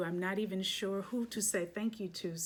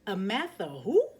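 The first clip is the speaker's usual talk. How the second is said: surprised exclamation; 8 dB louder than usual. Acted. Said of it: "They both exclaimed".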